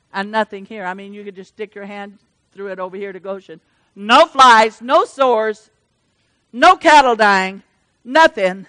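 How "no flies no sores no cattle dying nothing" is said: preaching at full force. Acted. Preacher rousing the congregation, loud fervent preaching. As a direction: lift the church with a rising prayer.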